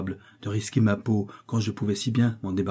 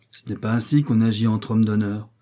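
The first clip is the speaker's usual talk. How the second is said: plain reading voice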